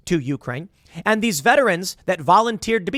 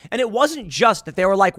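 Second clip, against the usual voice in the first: ordinary talk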